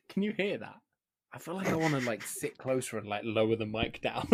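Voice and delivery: ordinary delivery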